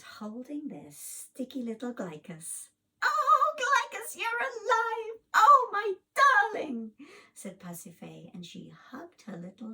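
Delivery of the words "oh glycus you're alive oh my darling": high, loud tearful-joy cry; mother greeting her revived child, overjoyed. Acted. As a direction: cry out in relief. Mother finds baby alive.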